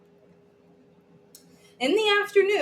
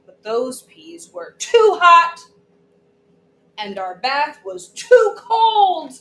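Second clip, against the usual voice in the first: whiny complaints; 6 semitones higher, 6 dB louder, slower than usual. Acted. Child complaining about everything.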